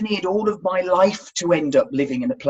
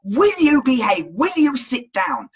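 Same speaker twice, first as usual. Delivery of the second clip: higher, mock-stern mum voice; scolding mum; 6 semitones higher, 4 dB louder than usual. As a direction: imitate a mum telling off a toddler. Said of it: "your mum says".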